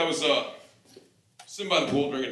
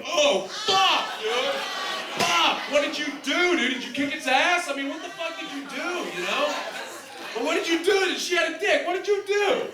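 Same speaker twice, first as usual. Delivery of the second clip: high, loud shocked yelling; frantic high-pitched disbelief, almost laughing; shocked shouting; 14 semitones higher, 5 dB louder than usual. Retold or imitated. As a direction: freak out at your buddy's news. Shocked reaction to the news.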